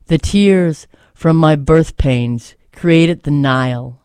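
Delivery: steady, earnest recital with weight on each line; poem reading. Acted. Host reading a Nikki Giovanni poem to listeners, earnest recital. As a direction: read it as a poem you believe in.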